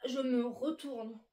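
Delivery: usual speaking voice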